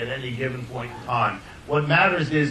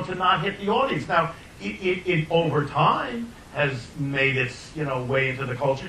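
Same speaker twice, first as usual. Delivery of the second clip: ordinary delivery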